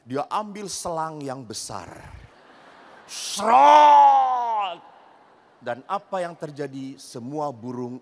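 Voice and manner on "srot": loud spitting hiss of a big hose; hose spray noise. Retold or imitated. Wife hosing the birds, loud hose noise. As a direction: blast it out like water from a fat hose.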